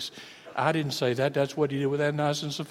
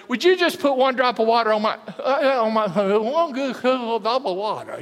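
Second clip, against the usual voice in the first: high, louder desperate plea for water; parched pleading; 8 semitones higher, 7 dB louder than usual. Retold or imitated. Thirsty listener imagined begging for water, desperate. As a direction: beg like your throat is burning. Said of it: "you said you might".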